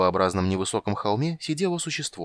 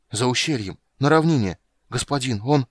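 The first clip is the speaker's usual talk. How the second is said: high, agitated, breaking off; urgent report; 4 semitones higher, 6 dB louder than usual. Acted. Shoemaker tells his lord where the dragon is, agitated. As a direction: blurt out the location in alarm.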